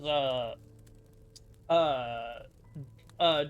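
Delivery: ordinary talk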